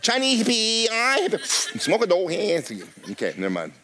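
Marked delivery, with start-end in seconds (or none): 0.0-2.7 high, laughing mock Chinese accent; a crude put-on Chinese accent, giggling. Preacher imagining a Chinese hippie, laughing mock accent. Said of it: "hard for me to imagine Chinese".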